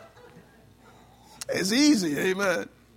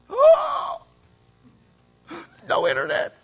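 mock panic with shocked gasps; hyperventilating; 7 semitones higher, 9 dB louder, slower than usual. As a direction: gasp in fake horror. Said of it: "I'm, I'm hyperventilating".

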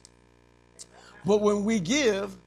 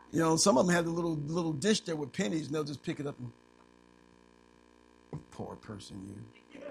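ordinary talk, nothing marked

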